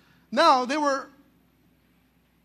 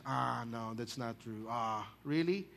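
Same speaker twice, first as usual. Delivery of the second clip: sceptical scoff; 10 semitones lower, 11 dB quieter than usual. Acted. Skeptics doubting the miracle.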